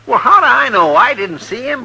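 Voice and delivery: high and loud, frustrated; loud exasperated reply; exasperated outburst. Acted. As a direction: snap back, fed up with questions. Frustrated under questioning about attack.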